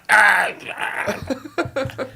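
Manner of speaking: high-pitched mock screech; henchman shriek. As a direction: screech like a cartoonish little henchman. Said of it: "Her little henchmen be like".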